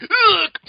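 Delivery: high loud mock ugh; imitating Misato's groan of dismay; hammed-up high ugh; disgusted groan. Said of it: "She's like".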